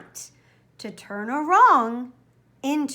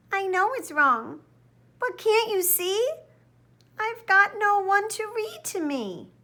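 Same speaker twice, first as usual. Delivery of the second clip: softly crying; 8 semitones higher than usual. A narrator's reading. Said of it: "softly he began to cry".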